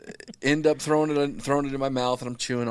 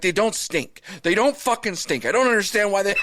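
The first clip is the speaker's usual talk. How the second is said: higher, louder mock protest; exasperated raised defense; 8 semitones higher, 6 dB louder than usual. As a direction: insist they don't stink, half-hammed. Defending his farts to family.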